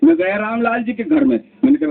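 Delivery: usual speaking voice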